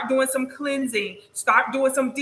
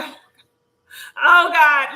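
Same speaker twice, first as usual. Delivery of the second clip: mock cry; 3 semitones higher, 7 dB louder, slower than usual. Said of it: "I be like".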